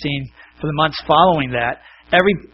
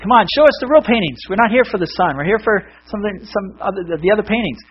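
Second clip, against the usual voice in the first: higher-pitched impatient complaint; antsy and upset; 6 semitones higher than usual. Acted. Crowd at the auction demands the real paintings, antsy and upset. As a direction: protest like a restless, upset crowd. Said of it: "antsy and upset".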